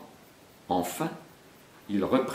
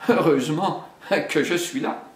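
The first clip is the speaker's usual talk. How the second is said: higher, self-satisfied; vain; 6 semitones higher than usual. Acted. Vain panther praises herself, smug. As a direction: preen as you say it. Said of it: "quelque peu vaniteuse".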